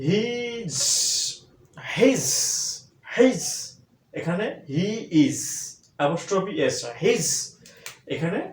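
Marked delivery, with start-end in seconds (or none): 0.1-3.4 high "His" ending in a z sound; teacher modelling "His"; he's with a z. Shown as a demonstration. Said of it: "এস এর উচ্চারণ দন্তুস্ব না হয়ে হবে জ".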